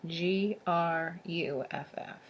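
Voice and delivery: ordinary delivery, nothing marked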